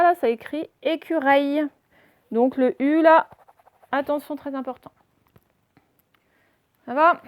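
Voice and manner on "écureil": "écureuil" turned into "écureil", final "eil"; higher, clear "eil" ending on ÉCUREUIL; wrong pronunciation. Shown as a demonstration. Teacher reading the misspelling aloud, mispronounced "écureil". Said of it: "si vous n'avez pas mis le U".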